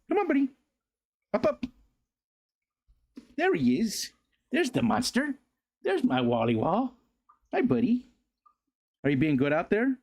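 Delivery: high-pitched pet-owner voice; high doggy-talk. Real, not acted. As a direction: coax a beloved dog over in singsong high pitch. Talking to pet dog Wally.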